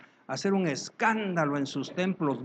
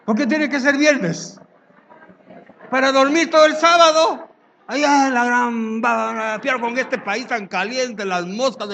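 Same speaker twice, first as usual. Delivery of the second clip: much higher, loud squeaky excuse; mock churchgoer; 7 semitones higher, 8 dB louder than usual. Pastor mocking a member's excuse for Friday vigils, high and loud. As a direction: squeal it like a lazy believer.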